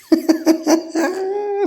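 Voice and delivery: squeaky high giggle; high laugh. Real, not acted. Owner laughing at clever pet, squeaky giggle.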